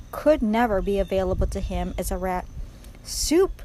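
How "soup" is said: high-pitched little moan. A narrator's reading. Rat overcome by memory of light, moaning. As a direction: moan the word with longing. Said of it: "moaned Roscuro".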